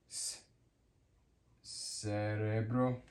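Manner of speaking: quiet hissed "S", then slow "Cerebro"; soft c. Shown as a demonstration. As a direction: stretch "Cerebro" from an s sound. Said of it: "sounds like letter S".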